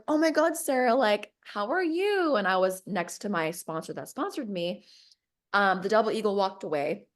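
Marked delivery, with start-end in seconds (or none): 0.1-2.4 high-pitched, gushing hello; mock gushy greeting; imitating excited greeting. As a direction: greet an acquaintance with lifted, delighted surprise. Bob greets her in the story.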